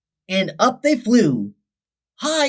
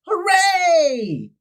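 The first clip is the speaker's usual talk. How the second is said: high, bright group cheer; cheering flock; 10 semitones higher than usual. A narrator's reading. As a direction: cheer together in triumph. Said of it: "shouted the flock in unison".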